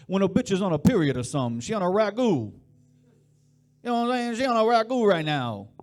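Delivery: loud, high-pitched Jersey Italian caricature; fast, pushy Jersey Italian accent. Acted. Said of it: "trying to do more accents".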